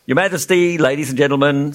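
ordinary delivery

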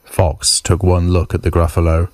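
usual speaking voice

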